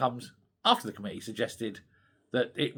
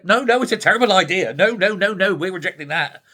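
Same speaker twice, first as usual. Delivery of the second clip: louder, higher mocking no no no; mock loud refusal; 5 semitones higher, 8 dB louder than usual. Senedd politicians rejecting the lying ban, mocked loudly. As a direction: parody politicians shouting down the idea. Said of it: "they've all said".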